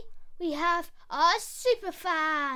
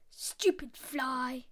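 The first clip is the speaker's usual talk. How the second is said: growly lion; 6 semitones lower, slower than usual. Acted. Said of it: "a growly one for Lion".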